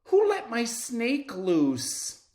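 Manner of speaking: high, loud, slow panicked yell; girl shouting in alarm. Acted. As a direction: cry out for the lost snake. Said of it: "Emily shouts".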